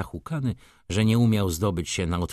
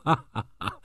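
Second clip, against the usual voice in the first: higher acted mock laugh; scornful laugh; 5 semitones higher, faster than usual. A narrator's reading. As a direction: laugh at him in indignation. Angry lady laughs scornfully.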